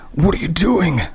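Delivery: high panicked scream. Acted. Graham screaming at Christy as she drags him, panicked. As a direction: scream it right into her ear. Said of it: "he screamed at her ear".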